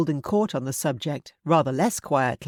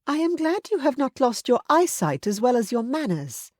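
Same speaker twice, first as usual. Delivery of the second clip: higher, dry tart retort; tartly; 8 semitones higher than usual. A narrator's reading. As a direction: needle him with a sharp edge. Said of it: "Missus Gardiner said tartly".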